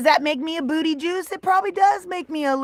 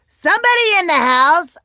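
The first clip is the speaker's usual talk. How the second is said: calling out; 6 semitones higher, 7 dB louder than usual. Real, not acted. Greeting a viewer arriving.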